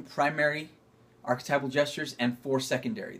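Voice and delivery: ordinary delivery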